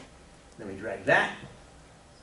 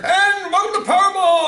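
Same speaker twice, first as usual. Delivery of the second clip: high, loud game-show announcer; hammed-up TV announcer welcome; 5 dB louder than usual. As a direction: boom it out like a lottery host. TV announcer voiced in retold ad.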